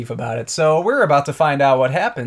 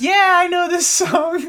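put-on much higher, slow girlish voice; imitating her; slower than usual. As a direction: draw it out high and sweet. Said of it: "she goes".

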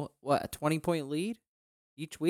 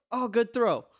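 surprised relief; 9 semitones higher, 5 dB louder than usual. Retold or imitated. Sudden relief after dreaded throw.

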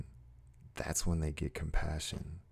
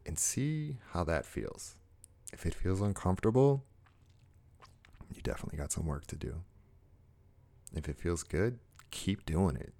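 soft, unhurried guided meditation voice; guided heart moment; 3 dB quieter than usual. Acted. Host guiding viewers to love themselves, soft meditative voice. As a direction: gently talk the listener into a calm moment.

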